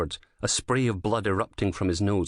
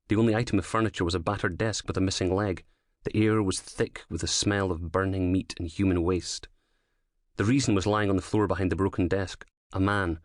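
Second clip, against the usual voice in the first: ordinary delivery, nothing marked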